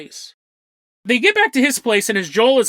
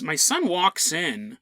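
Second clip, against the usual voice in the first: usual speaking voice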